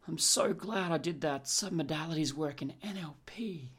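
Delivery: unvoiced whisper to yourself; a secret pleased aside; whispered thought. Client whispering to himself over his lunch salad.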